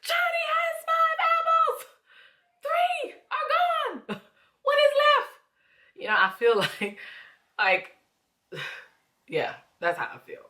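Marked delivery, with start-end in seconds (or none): 0.0-5.3 much higher, exasperated teacher voice breaking into a laugh; exasperated God. Retold or imitated. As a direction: spell it out, out of patience, laughing at the end. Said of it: "And God like".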